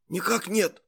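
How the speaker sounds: husky, raspy reply; hoarse voice. A narrator's reading. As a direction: rasp out a short answer. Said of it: "ответил сиплым голосом".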